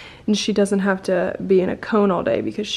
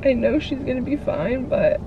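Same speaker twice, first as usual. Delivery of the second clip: talking through tears; teary, breaking voice; crying; 4 semitones higher than usual. Real, not acted. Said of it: "I don't know why I'm crying".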